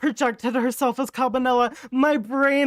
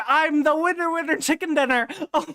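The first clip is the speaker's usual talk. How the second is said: ordinary talk, nothing marked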